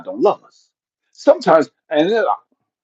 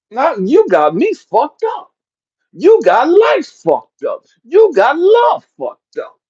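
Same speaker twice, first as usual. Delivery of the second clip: slow, higher and louder with each line; building outrage; 10 semitones higher, 6 dB louder, slower than usual. Real, not acted. Comic answering the daughter's whining, slow and rising outrage. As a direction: hammer each phrase harder. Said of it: "building outrage".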